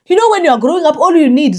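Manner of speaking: high, loud, real emotional surge; close to tears. Real, not acted. Vlogger recalling childhood hurt to viewers, holding back tears. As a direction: voice rising as you fight back tears. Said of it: "I'm not going to cry".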